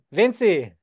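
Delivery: teacher calling the student away from her laundry; shout. Pretend. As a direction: call her away from the washing. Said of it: "I can just shout".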